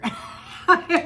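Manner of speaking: sudden squealing laugh; high burst of laughter. Real, not acted. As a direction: let a big laugh burst out. Laughs at the story.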